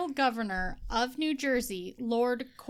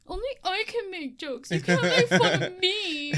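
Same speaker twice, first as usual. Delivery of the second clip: high, hammed-up childish whine; mock spoiled brat; 6 semitones higher, 4 dB louder than usual. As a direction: protest like a petulant child. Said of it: "you're doing that really well".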